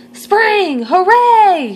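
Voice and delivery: high, loud joyful cheer; cheering shout. Acted. Reporters celebrating the forecast, loud joyful cheer. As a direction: whoop for joy. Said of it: "shouted the crowd".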